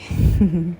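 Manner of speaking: brief amused giggle; little closed laugh; short chuckle. Real, not acted. Giggles after naming him si boy.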